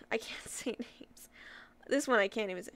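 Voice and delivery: laughing whisper at herself; sheepish whispered laugh; whispered aside. Real, not acted. Embarrassed aside while reading names.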